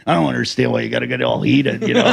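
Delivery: low, soft baffled whine; clueless sleaze. Retold or imitated. Craig shrugs off her anger, low and baffled. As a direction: sound genuinely puzzled and low. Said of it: "he's like".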